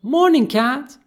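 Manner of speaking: high, loud, bright fish voice; chirpy goldfish greeting. Acted. Goldie waking grumpy Kat in the morning, chirpy. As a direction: greet brightly to rouse someone.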